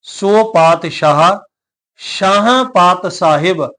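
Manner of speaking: slow recitation, ਪਾਤਸ਼ਾਹ and ਸ਼ਾਹਾਂ with clear sh; measured verse, sh in ਪਾਤਸ਼ਾਹ and ਸ਼ਾਹਾਂ; careful demonstration. Shown as a demonstration. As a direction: draw out ਸ਼ਾਹਾਂ as "shaahaan", ਸਾਹਿਬ with plain s. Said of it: "ਦੁਬਾਰਾ ਫਿਰ ਧਿਆਨ ਦੇਣਾ".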